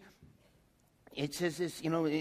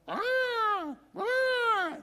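high mock demon wail; demon shriek; 4 dB louder than usual. Demon manifesting in the church, shrieking high. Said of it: "He goes".

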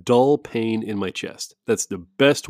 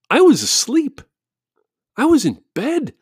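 mock indignant; 14 semitones higher, 4 dB louder than usual. Real, not acted.

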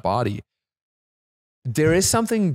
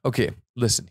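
ordinary delivery

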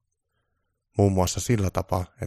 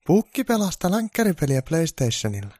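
lightly voiced higher Mrs Claus; a hint of Mrs Claus's higher voice; Mrs Claus explaining; 10 semitones higher, 4 dB louder than usual. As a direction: play her shrugging it off.

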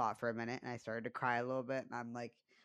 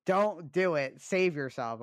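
raised, louder urgent warning; dead-serious plea; 4 semitones higher, 7 dB louder, slower than usual. Real, not acted. Warning listeners off snooping in phones, serious and forceful. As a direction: urge them hard to save themselves. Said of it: "I'm so fucking serious".

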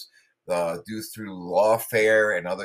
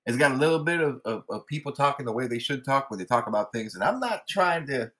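higher-pitched Georgia drawl; Southern drawl; 6 semitones higher than usual. Acted. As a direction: lay on a laid-back Southern accent, pitched up. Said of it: "you're misappropriating their accent".

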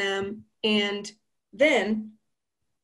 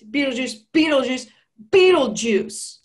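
building summons; 3 semitones higher, 5 dB louder than usual. Retold or imitated. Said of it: "you gotta say my name a couple more times".

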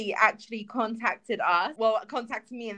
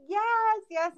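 higher-pitched happy yes; imitating overjoyed mom; 9 semitones higher than usual. Retelling mom's reaction to the chart news, overjoyed. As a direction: cheer like a delighted mom. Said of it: "she was so happy".

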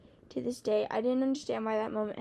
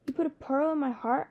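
higher, louder astonished question; exclaiming in shock; 5 semitones higher, 4 dB louder than usual. Acted. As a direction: blurt it out in disbelief. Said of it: "I exclaimed".

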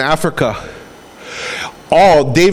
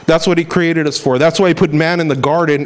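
ordinary delivery

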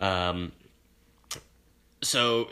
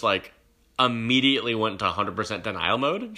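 ordinary delivery

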